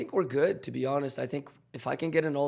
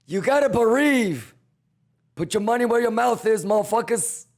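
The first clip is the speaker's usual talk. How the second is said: hype shout; 7 semitones higher, 5 dB louder than usual. Real, not acted. Hyping chat.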